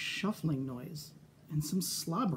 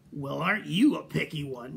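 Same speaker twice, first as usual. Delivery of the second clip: sniffy; 2 semitones lower, 3 dB louder than usual. Acted. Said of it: "she sniffed".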